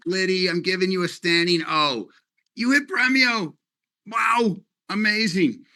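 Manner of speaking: high-pitched, wowed congratulations; excited praise. Real, not acted. Leader giving Liddy a standing ovation, excited praise. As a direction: gush with admiration, voice lifted high. Cheering a team member's achievement.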